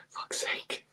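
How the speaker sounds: very soft, voiceless whisper; whispered curse. Real, not acted. Commentator cursing to himself during the match, whispered. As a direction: swear under your breath. Swearing under breath on stream.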